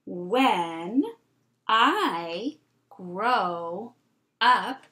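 each word slow and separate; word by word reading. Shown as a demonstration. Teacher reading with the class: word by word. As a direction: point and read one word at a time. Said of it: "read through this two times together".

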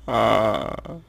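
ordinary talk, nothing marked

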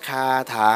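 คาถา loud, both syllables held on an even tone; teacher's emphatic model of คาถา, long unraised vowels; level-tone model. Shown as a demonstration. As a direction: say คาถา firmly without letting the pitch climb. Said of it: "ไม่ต้องทำเสียงสูงขึ้นไปอีกนะ".

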